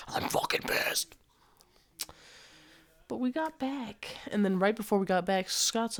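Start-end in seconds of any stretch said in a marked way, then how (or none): 0.1-1.1 breathy, annoyed whisper to yourself; pissed-off whisper. Retold or imitated. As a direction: hiss it under your breath, fed up. Frustrated muttered aside to himself.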